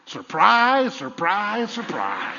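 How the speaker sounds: drawn-out goofy Gomer Pyle sing-song; preacher jokingly surprising the high priest at his door. Retold or imitated. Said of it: "I'd have pulled a Gomer Pyle".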